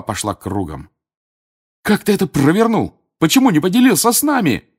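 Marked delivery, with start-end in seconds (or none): 1.8-4.7 loud, high-pitched excited yell; outraged, thrilled shouting. A narrator's reading. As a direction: demand it at the top of your voice. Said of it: "завопил Фред".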